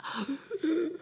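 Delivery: quick soft high gasp; acted gasp. Woman reacting to a Lifetime movie, acted gasp. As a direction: gasp in mock shock.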